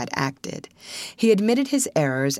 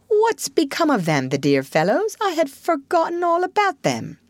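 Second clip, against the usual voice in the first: airy, higher-pitched casual query; light, offhand; 11 semitones higher than usual. A narrator's reading. Toad asking after forgotten friends, lightly. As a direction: ask it breezily, as an afterthought. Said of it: "said Toad lightly".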